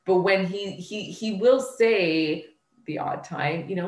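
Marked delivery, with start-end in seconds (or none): none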